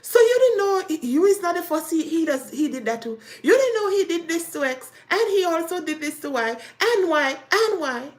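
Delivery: high, loud taunting list. Retold or imitated. The other woman taunts the wife, high and loud. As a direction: jab each name at her.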